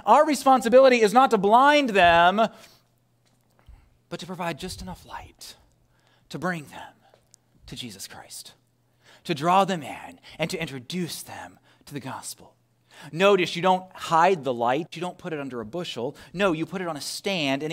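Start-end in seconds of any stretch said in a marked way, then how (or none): 4.1-12.6 very soft, fading almost to a whisper; hushed preaching. Real, not acted. Preacher urging believers to bring friends gently, hushed. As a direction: draw them in with a gentle hush.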